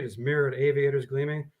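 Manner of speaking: usual speaking voice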